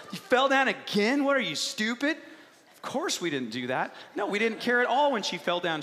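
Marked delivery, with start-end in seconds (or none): 0.1-2.2 louder, higher pretend scolding; mock yelling. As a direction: yell in sham exasperation at the child. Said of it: "we'd just yell at her".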